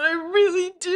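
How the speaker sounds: high, tearful parody line; mock crying Tom. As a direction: plead it high and weepy. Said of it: "But he's like".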